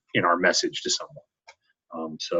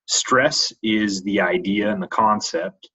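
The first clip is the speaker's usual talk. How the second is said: usual speaking voice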